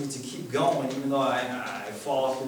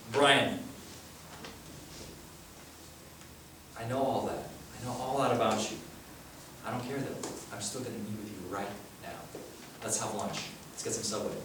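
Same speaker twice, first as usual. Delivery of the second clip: softer, tender reassurance; gentle Jesus; 5 semitones lower, 10 dB quieter than usual. Acted. Jesus invites Brian to lunch, soft and tender. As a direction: quietly welcome him to lunch.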